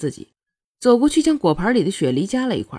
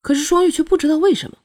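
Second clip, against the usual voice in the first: ordinary delivery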